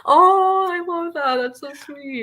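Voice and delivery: high-pitched "aww" gush, softening at the end; touched cooing. Real, not acted. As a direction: coo it high, moved by a sweet story. Touched by co-host's story.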